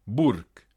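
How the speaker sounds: "burk" ending on a hard K; final G as K. Shown as a demonstration. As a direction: close "burk" with K after the R. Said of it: "G en finale après consonne se prononce que". (« burk » avec K final)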